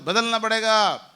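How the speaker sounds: loud rallying shout. Shown as a demonstration. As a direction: shout it out to the hall. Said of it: "विनय भाई जोर से बोलो".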